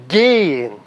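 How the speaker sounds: Gehen with a long, stressed Ge; stress on first syllable. Shown as a demonstration. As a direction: lean on Ge in Gehen. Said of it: "tá no ge".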